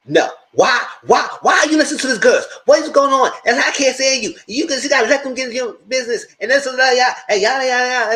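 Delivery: high, loud mock outrage; mock outraged man. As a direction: ham up his indignant rant. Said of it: "He said".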